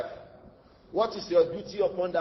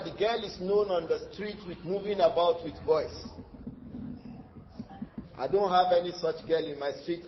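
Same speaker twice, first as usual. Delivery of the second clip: ordinary talk, nothing marked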